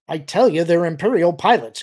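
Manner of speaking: firm, pressing tone; insistent. A narrator's reading. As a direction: insist on being believed. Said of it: "Carib insisted".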